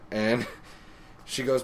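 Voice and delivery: usual speaking voice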